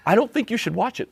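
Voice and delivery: high, lightly voiced wife warning; wife's warning. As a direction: play the wife cautioning him.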